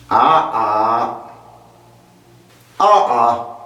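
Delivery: negating ah-ah. Shown as a demonstration. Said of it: "Eine Verneinung".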